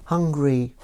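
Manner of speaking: a quiet, eerie inner voice; voice in his head. Acted. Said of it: "he hears in his mind someone saying".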